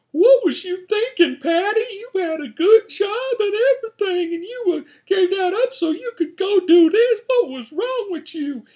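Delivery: very high, squeaky mock voice of a scared movie character. Shown as a demonstration. Reviewer imitating the movie character scolding herself, squeaky hammed-up mock voice. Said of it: "I'm gonna do this just as an example".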